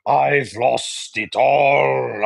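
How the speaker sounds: very slow mock Russian lament on all; Russian accent, drawn out. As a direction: stretch I've lost it all like a ruined Russian gambler. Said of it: "Wasn't my best Russian impression".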